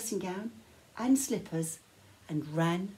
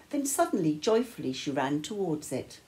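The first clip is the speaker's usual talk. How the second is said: plain reading voice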